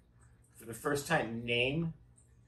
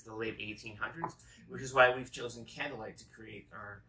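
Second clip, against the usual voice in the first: ordinary talk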